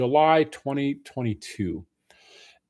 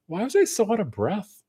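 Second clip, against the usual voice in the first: higher-pitched, mock baffled question; puzzled self-quote; 9 semitones higher than usual. Vlogger retelling their reaction while editing the workout video, mock puzzled. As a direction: re-enact your own puzzlement, pitched up.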